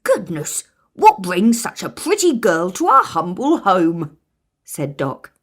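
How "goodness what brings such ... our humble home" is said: put-on otherworldly, louder old dwarf; Doc the dwarf. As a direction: greet her kindly. Doc greets her.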